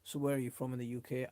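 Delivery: ordinary talk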